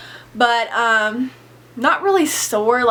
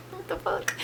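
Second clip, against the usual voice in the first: whispered, much softer, disbelieving; shocked whisper; 8 semitones higher, 14 dB quieter than usual. Retold or imitated. As the piercer redoes the dots, a whispered shocked curse. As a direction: breathe it out in stunned disbelief.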